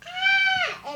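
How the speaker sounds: very high shriek of fright; scream. Acted. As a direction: shriek as the T-Rex appears. Characters see the T-Rex.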